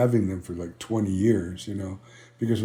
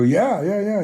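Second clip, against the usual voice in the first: eager agreement; 10 semitones higher, 3 dB louder than usual. Retold or imitated. Acted eager answer to caretaker.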